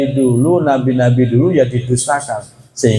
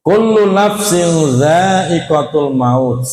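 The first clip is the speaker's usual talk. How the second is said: higher, slow chanted recitation; 5 semitones higher, much slower than usual. Acted. Preacher recites verse to congregation, slow and chanted. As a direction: intone the verse slowly.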